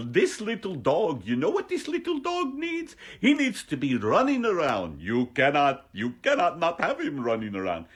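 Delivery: loud, high sing-song mock Swedish; wildly hammed-up Swedish accent, swooping high; accent turned Swedish. Comedian as the vet advising on a dog, botched Swedish accent. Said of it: "all my accents turn into Swedish".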